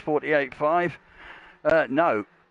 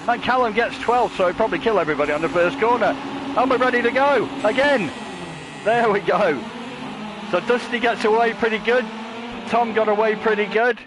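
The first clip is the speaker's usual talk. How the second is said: higher, louder race call as the lights go out; breathless excited commentator at the green flag; excited play-by-play; 9 semitones higher, 5 dB louder than usual. Acted. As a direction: call the start like the crowd is on its feet. Live race-start commentary.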